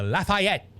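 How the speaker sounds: "Lafayette" with a wide "fye" in the middle; out-of-towner's "lah-fye-YET"; outsiders' Lafayette. Shown as a demonstration. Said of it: "I've even had people say".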